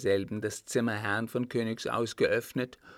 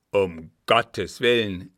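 loud, drawn-out cry of warning; alarmed exclamation; 9 dB louder, slower than usual. A narrator's reading. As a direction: call out slowly and forcefully in the old servant's voice. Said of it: "rief Florian".

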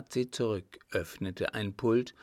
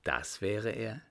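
ordinary delivery

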